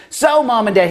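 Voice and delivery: put-on child's announcement. Girl addressing Mom and Dad, proud announcement. Retold child wrapping up a story.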